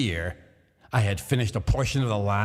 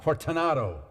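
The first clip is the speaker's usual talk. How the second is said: called name, pitched up; calling out; 6 semitones higher than usual. A narrator's reading. Montresor calling into the niche, raised pitch. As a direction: call into the niche. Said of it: "I called aloud".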